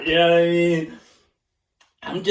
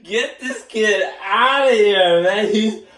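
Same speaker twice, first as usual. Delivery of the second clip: slow, louder scolding of a bad kid; mock scolding; 4 semitones higher, 5 dB louder, slower than usual. Mocking himself as the bad student, slow loud scolding. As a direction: drag out the scolding. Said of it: "why you're imitating me".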